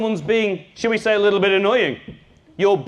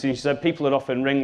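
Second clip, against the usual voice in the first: ordinary delivery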